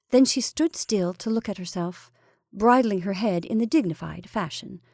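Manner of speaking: ordinary delivery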